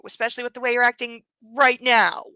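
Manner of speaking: ordinary talk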